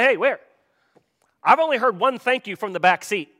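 loud, high-pitched acted complaint; indignant parent. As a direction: call out the ungrateful kids in the back. Acted indignant parent demanding thanks.

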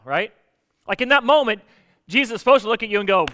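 ordinary talk

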